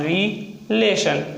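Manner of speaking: "रिलेशन" said slowly, falling; drawn-out word. Shown as a demonstration. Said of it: "next है रिलेशन". ("रिलेशन" धीरे खींचकर)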